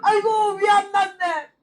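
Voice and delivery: high, slow, thrilled; overjoyed slow aigo; joyful exclamation. Retold or imitated. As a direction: cry out in relief. Joy at healing.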